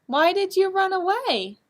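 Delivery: pleading question. Acted. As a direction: ask it up high, hurt and puzzled. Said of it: "asked little cheetah".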